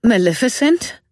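high, loud trembling call; zitternde Stimme. A narrator's reading. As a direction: quaver the name in fear. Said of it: "fragte Circe mit zitternder Stimme". (mit zitternder Stimme gerufen)

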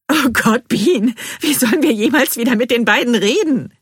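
high, loud, hysterical wail; hysterical outburst. A narrator's reading. Narrator to Bean about ever facing the neighbours, hysterical outburst. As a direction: cry out in giddy horror.

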